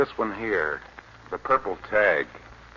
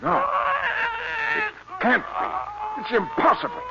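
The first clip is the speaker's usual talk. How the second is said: much higher, stunned cry; shocked disbelief; slower than usual. Acted. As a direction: gasp at something that cannot exist. Reporter sees same monster.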